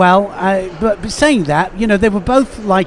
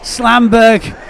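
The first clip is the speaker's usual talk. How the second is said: raised, delighted call ending in a laugh; excited outburst; 3 semitones higher, much slower than usual. Real, not acted. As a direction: marvel aloud and laugh it out. Commentator reacts to sudden attack.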